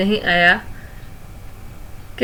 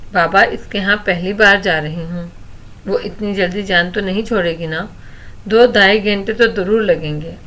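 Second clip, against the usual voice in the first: usual speaking voice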